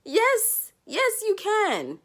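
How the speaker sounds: high, excited hype for a sister; cheering friend. Pretend. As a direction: cheer her on like her biggest fan.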